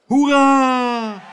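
high, loud, drawn-out hurrah; crowd cheer shout. A narrator's reading. Audience cheering the mayor's speech, shouting. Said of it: "schreeuwde het publiek".

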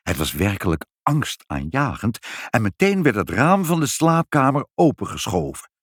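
ordinary delivery

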